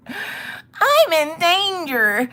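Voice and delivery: high, very drawn-out singsong; comically doomed little voice; mock dread. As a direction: stretch it out in a silly high voice. Mock voice for son's peril.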